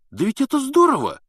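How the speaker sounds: high, loud delighted cry. A narrator's reading. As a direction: cheer at the good news. Ron delighted.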